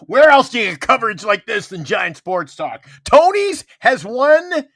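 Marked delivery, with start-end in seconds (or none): 3.0-4.6 dramatic announcement. Acted. Repeats award dramatically, slowly.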